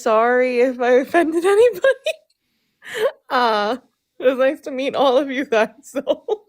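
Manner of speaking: giggling nervously through goodbye; awkward little laughs while talking. Real, not acted. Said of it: "it's just a nervous laugh".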